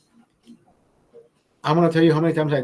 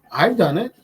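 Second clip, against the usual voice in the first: ordinary talk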